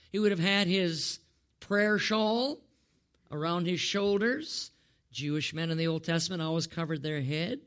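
ordinary talk, nothing marked

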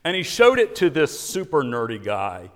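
usual speaking voice

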